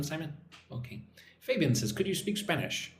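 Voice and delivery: usual speaking voice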